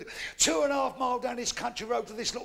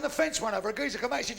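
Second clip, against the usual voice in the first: ordinary talk, nothing marked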